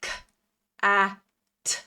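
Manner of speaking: each sound alone with pauses; separated sounds. Shown as a demonstration. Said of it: "put all of those sounds together".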